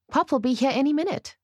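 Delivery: high, lilting and eager; playful. A narrator's reading. Bride expecting Pop any minute, playful. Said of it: "I say playfully".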